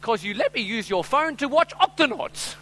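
high, loud, slow child impression; drawn-out, high-pitched kid voice; little daughter's voice. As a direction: play a little girl proudly giving her reason. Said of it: "I hear this little voice in the back".

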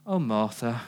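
tenderly correct, not rebuke; pastoral tender. Retold or imitated. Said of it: "that pastoral".

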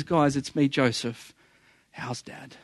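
low voice fading to very soft; understated, emotional confession, no real sobbing; retold tearful reveal. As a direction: let it sink gently into a near-hush. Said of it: "crying, tears down his face".

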